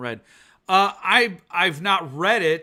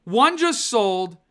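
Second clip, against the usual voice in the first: dismayed outcry; 6 semitones higher, 8 dB louder than usual. Real, not acted. Sees an arc just sold.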